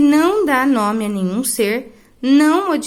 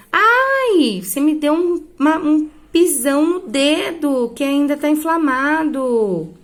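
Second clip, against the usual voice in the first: high yelp "Ai" then an aggrieved whine about the sore toe; pained complaint; 4 semitones higher, slower than usual. Shown as a demonstration. Teacher acting out a stepped-on toe for students, pained. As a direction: cry out like someone just stepped on your hurt toe. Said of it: "tô com dor em alguma parte do corpo".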